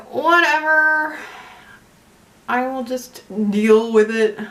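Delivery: slow, drawn-out mock resignation; hammed-up "fine, I'll cope"; mock resigned. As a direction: drag it out as if grudgingly giving in. Acts out own grudging acceptance in store.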